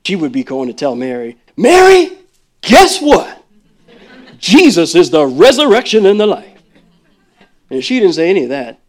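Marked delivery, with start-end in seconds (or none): 1.6-6.5 much higher and louder, breathless good news; a shout of joyful news; excited. Pretend. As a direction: call out to Mary, thrilled. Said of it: "if she was excited".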